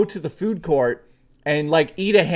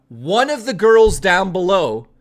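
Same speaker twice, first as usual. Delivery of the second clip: ordinary talk, nothing marked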